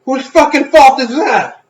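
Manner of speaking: high, loud, drawn-out mock outrage; exasperated yell. Reply to dad grumbling about cold food, exasperated yell. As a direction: throw the blame back loudly, pitch way up. Retold snap at forgetful dad.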